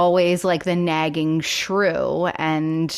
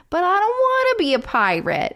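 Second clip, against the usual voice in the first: put-on high whiny pouty kid voice; whiny little boy voice; 12 semitones higher than usual. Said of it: "in that little whiny voice".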